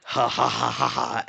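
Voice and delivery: loud mock laughter; read-out laugh. Dan laughing at Emma's joke, loud and mock. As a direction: spell out the laugh loudly.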